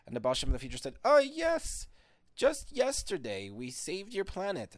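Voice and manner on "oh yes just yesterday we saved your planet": eager reply. Acted. Eager reply to Atlantis caller.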